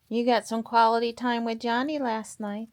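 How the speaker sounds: high, gentle pet talk; sweet talk to a pet. Real, not acted. Cooing to a pet.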